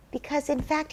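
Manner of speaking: ordinary talk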